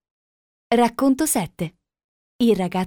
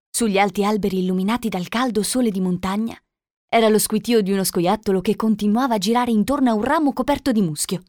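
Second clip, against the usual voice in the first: ordinary delivery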